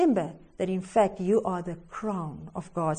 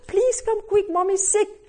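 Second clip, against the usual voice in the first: put-on urgent child's plea; 9 semitones higher than usual. A narrator's reading. Boy begs grandmother urgently.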